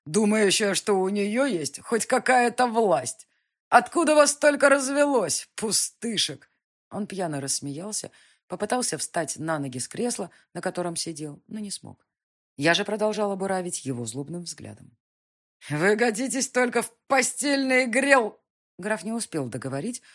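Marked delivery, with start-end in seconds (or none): 0.0-6.5 put-on loud, high sneering drunk nobleman; drunken count sneering. 15.7-18.4 put-on loud, high, slow drunken jeer; drunken insult. Drunk count insults heroine.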